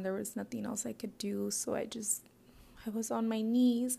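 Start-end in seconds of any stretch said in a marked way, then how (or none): none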